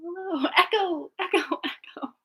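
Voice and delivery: high, fading hello echo. Pretend. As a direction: call out like into a canyon. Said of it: "And I'm like".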